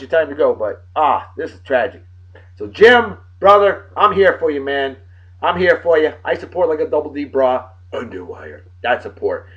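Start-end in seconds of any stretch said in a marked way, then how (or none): none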